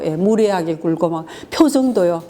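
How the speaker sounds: usual speaking voice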